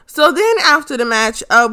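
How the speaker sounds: ordinary talk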